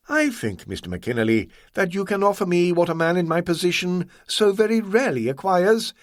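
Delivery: lightly voiced suave villain; smooth villain voice. A narrator's reading. Ah Wong voiced by narrator.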